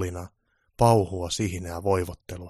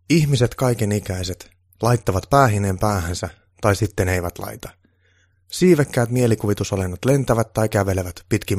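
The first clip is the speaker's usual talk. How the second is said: declaiming a poem, a little louder; poem recital; 3 semitones higher, 10 dB louder than usual. Acted. Kuustonen recites his new poem to Santa, declaiming. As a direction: recite it like a proud poet at a pub.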